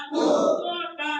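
drawn out call. Shown as a demonstration. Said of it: "তারপরেরটা".

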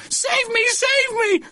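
very high, squealing woman's plea; panicked cry. A narrator's reading. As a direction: squeal helplessly for rescue. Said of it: "cried the rigid woman".

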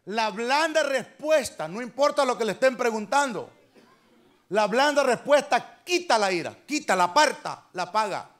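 forceful preaching. Acted. Preacher to the congregation, forceful preaching. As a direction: preach it with weight, stressing blanda and quita.

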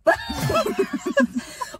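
high shrieking laugh. Real, not acted. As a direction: explode into laughter. Burst out at friend's answer.

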